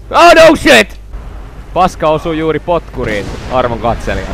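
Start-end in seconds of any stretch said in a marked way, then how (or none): 0.1-1.0 loud, high burst of alarm; alarmed shout. Real, not acted. Player reacting to the dragon, alarmed shout.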